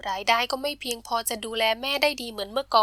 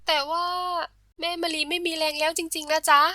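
higher, mildly plaintive protest; tearful pleading; 5 semitones higher, 4 dB louder than usual. A narrator's reading. Girl pleading for her weak mother, tearful. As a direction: plead on the edge of tears. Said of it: "มะลิน้ำตาคลอ".

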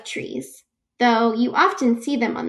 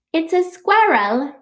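higher-pitched imitation of an Australian child's accent; excited Australian girl; 4 semitones higher, 3 dB louder than usual. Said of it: "that's how she said it. She was really excited".

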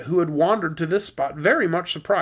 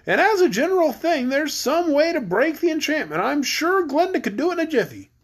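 high, singsong storybook straw man; comforting Scarecrow; 9 semitones higher than usual. Acted. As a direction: reassure in a high character voice. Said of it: "urged the Scarecrow in a comforting voice".

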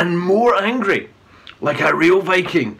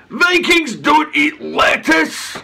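loud, high and slow indignant shout; 10 semitones higher, 4 dB louder, slower than usual. A narrator's reading. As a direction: declare it with outrage. Chief rejects lettuce angrily.

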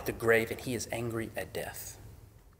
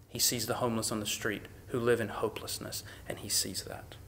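hushed whisper; 7 dB quieter than usual. Real, not acted. Tender hushed sermon moment.

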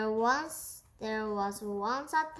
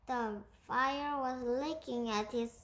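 ordinary delivery, nothing marked

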